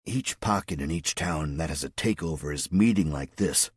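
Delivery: ordinary delivery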